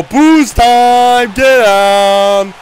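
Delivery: loud triumphant yell; victory shout. Real, not acted. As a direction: roar the celebration. Celebrating winning fight.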